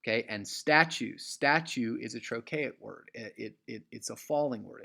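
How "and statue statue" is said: statue with the stress on STA; STA-tue, emphatic start, lighter ending; stress on first syllable. Shown as a demonstration. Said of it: "It starts with the emphatic sta".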